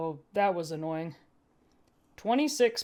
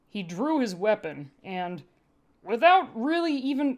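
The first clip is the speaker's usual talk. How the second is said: ordinary delivery, nothing marked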